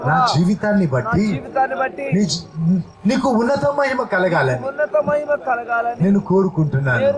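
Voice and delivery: preacher leading prayer aloud, phrase by phrase; fervent prayer. Acted. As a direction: pour it out to God with the church. Leads congregation in prayer. (ప్రార్థనలో ఉత్సాహంగా)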